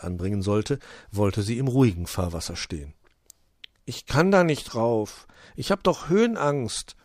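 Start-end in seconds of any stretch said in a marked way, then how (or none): 3.8-7.0 higher-pitched, sheepish confession; mildly abashed character voice; a bit ashamed. A narrator's reading. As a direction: admit it with a touch of embarrassment. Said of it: "murmelte Puh etwas beschämt".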